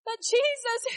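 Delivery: ordinary delivery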